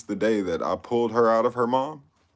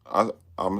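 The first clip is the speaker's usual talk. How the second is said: ordinary talk, nothing marked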